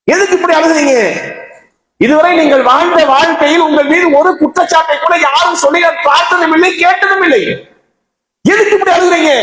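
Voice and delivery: high, urgent pleading questions in a preacher's retelling; insistent questioning. Acted. Friend asking dying Salman why he weeps, insistent questioning.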